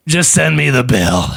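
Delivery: mild call across a distance; casual called-out line. Acted. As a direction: call it out, drawn through without a full shout. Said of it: "he calls out".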